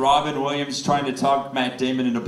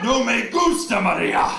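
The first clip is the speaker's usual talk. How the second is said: mock Spanish; 10 semitones higher, 3 dB louder than usual.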